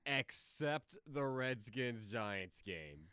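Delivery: drawn-out deadpan exception; slow and pointed. Real, not acted. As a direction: slowly stress the exception.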